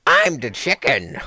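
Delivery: loud, slow, silly put-on voice; drawn-out, loud dopey character voice; goofy dim-witted voice. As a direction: blurt it loudly like a total goofball.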